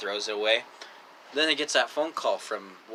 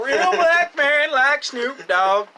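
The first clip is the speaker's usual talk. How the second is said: mock impression; 13 semitones higher, 8 dB louder, much slower than usual. Joking impression.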